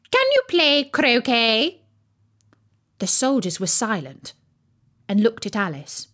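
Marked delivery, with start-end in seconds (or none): 0.1-1.8 raised, drawn-out imperious question; shouted. A narrator's reading. Queen shouts a question at Alice. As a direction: call it out as the Queen. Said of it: "shouted the Queen".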